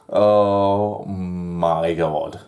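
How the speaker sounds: very slow, stretched-out reaction; drawn-out disbelief. Real, not acted. Reviewer reacting to Jacob's absurd line, drawn-out disbelief. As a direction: drag every word out in disbelief.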